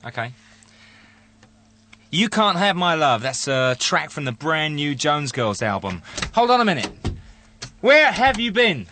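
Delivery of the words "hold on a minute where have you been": mock scolding. Host mock-scolding late colleague.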